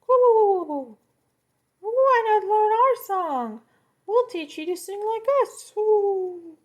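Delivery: very high, loud cooing, slow; flustered cooing birds; pigeon voices. Acted. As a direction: coo like fluttering pigeons. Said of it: "The pigeons".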